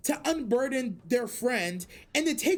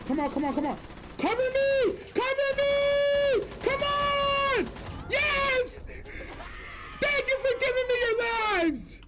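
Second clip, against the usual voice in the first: high frantic battle shouting; cover me shout; 10 semitones higher than usual. Real, not acted. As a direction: yell for cover mid-fight. Game clip shouting.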